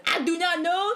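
hammed-up French farce, each line stretched; horrible French accent. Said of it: "in a horrible French accent, he goes".

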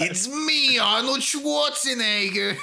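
hammed-up bad action-star impression; mock Arnold Schwarzenegger. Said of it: "like, a really bad impression".

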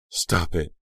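short, sharp command. A narrator's reading. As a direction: order it sharply. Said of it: "Aunt Margaret insisted sharply".

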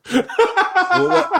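high laughing burst. Real, not acted. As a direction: break into laughter on the word. Laughing at the whisper idea.

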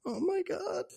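high, strained mock gasp of words; stunned aside. Keaton turns away from lingerie, stunned high squeak. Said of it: "just turns away and goes".

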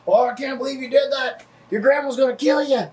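high squeaky outraged voice; mock the outraged commenters; shocked commenter. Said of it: "Thousand comments".

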